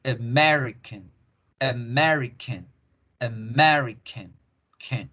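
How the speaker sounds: "American" slowly, MER strong, ending a weak "kun"; stress on MER. Shown as a demonstration. Teacher shows where the force goes, stress on MER. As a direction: lean on MER in "American", schwa either side. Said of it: "yo hago fuerza en Mary".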